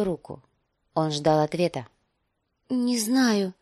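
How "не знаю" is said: trembling voice. Acted. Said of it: "дрожащим голосом".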